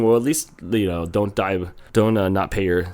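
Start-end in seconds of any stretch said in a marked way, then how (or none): none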